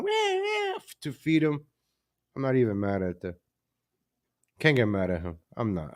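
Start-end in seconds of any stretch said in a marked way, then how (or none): 0.0-0.8 high, mock crying meow "Wah, wah"; imitated cat meow. Streamer imitating his cat waking him at night, high meowing. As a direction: whine it up high like a hungry cat.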